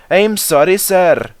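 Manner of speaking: sheepish Donald. Acted. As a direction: apologise to the boss. Donald apologises.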